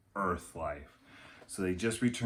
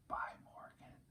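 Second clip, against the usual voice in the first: soft, sad whispered goodbye; 20 dB quieter than usual. Acted. Jack saying goodbye to Morgan after the treehouse vanishes, a sad whisper. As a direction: breathe the goodbye out in a whisper, sorrowful. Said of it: "he whispered sadly".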